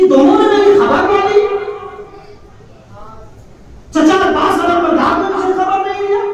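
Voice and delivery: high, loud wounded reproach; hurt complaint. Acted. Sick daughter left behind, loudly reproaching in a high voice. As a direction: cry out that nobody came for her.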